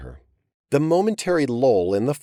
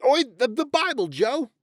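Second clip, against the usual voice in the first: much higher, fast, panicked yell; furious shout. Acted. Alvin yells at Joe over the fallen Bible, furious and much higher. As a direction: yell to stop him wrecking the Bible. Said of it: "Alvin shouted furiously".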